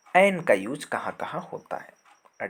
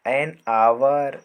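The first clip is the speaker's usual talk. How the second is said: slow drill; 8 dB louder, much slower than usual. Shown as a demonstration. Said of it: "teacher drills examples slowly".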